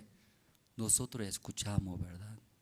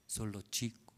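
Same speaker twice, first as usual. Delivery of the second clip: normal talking voice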